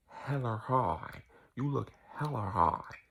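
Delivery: very slow, stretched HELLA HIGH; drawn-out imitation. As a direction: drag out each word, mimicking him. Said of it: "he said it just like this".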